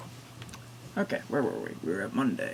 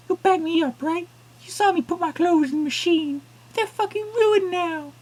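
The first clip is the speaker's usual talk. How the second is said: angry woman; 5 dB louder, slower than usual. Acted. Angry woman confronts narrator.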